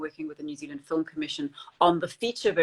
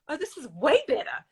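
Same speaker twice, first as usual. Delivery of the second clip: mock realization; 9 semitones higher, 4 dB louder than usual. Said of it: "you read it again and you go".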